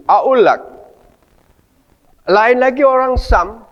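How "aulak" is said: aulak with the qaf dropped to a glottal stop; Egyptian Arabic. Shown as a demonstration. Teacher imitates how Egyptians say aulak. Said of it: "Qaf itu dibacanya u".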